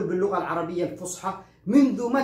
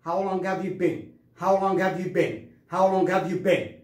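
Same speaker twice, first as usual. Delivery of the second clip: slow, lower-pitched model repetition; practice drill; 4 semitones lower, much slower than usual. Shown as a demonstration. Teacher drilling students on the question, slow and measured. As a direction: say it slowly for learners to copy. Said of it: "تدرب معي".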